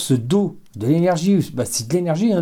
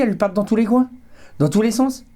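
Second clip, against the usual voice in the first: excited; 9 semitones higher than usual. Real, not acted. Rods swinging wildly.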